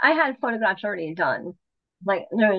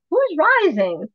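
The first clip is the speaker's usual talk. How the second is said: puzzled; 7 semitones higher, 4 dB louder, slower than usual. Retold or imitated. Award announcement, turning to Deb.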